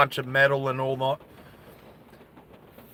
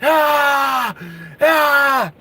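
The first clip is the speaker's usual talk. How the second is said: high grief-stricken wail; two long cries over a fallen friend; anguished scream; 9 semitones higher than usual. Acted. Tommy wails over dead Stu.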